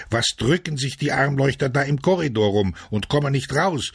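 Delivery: high-pitched indignant complaint; angry grumbling. A narrator's reading. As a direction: gripe in a fury at those dawdling inside. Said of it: "den packte die Wut".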